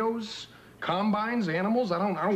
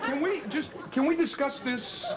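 exasperated plea; 8 semitones higher than usual. Real, not acted.

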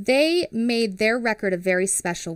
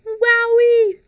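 wowie; 14 semitones higher, 10 dB louder than usual. Acted. Excited exclamation to kids.

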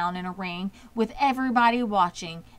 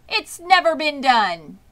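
loud, higher, slow over a loudspeaker; booming public-address voice; loudspeaker announcer; 6 semitones higher, 7 dB louder than usual. A narrator's reading. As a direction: announce it firmly to the crowd. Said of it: "said the loudspeaker".

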